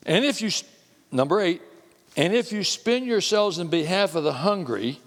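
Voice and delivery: high, loud scripture preaching. Acted. Preacher reading verse eight to the congregation, loud and fervent. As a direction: preach the verse with rising fervor.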